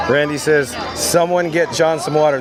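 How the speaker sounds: usual speaking voice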